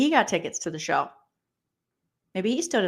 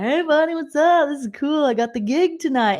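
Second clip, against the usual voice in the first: imitating Jerry; 7 semitones higher than usual. Vlogger mocks Jerry O'Connell greeting Quinn, high chirpy host voice. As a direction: play the cheery host, pitched up.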